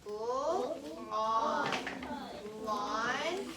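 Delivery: teacher sounding out a word for the class. Shown as a demonstration. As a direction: spell out "Pull on lawn" slowly, each sound apart. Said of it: "let's finger spell that word".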